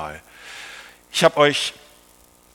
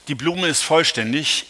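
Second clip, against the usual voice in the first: ordinary talk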